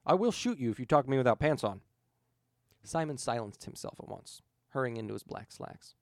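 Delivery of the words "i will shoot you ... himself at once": ordinary delivery, nothing marked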